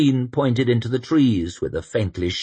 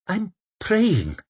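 put-on pleading young man, higher; begging; 12 semitones higher than usual. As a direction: beg him earnestly. Sufin voiced.

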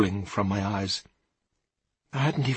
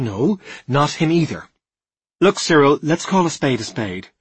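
loud, high, forceful plain speaking; emphatic insistence; 5 semitones higher, 7 dB louder than usual. A narrator's reading. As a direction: press the point with raised voice and pitch. Alice forcefully demands a straight answer.

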